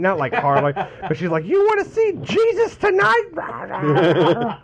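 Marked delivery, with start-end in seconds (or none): 1.4-4.6 high, slow, drawn-out mock menace; mom's mock threat. Son retelling mom choking him, mock threat. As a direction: play the furious mom half-joking. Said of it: "Getting all fucking pissed off".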